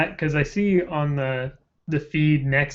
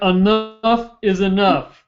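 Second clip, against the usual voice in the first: Bernie Sanders impression with Brooklyn accent; slow Brooklyn accent, Bernie style; 6 semitones higher, 4 dB louder, much slower than usual. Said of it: "I can't do the Brooklyn accent".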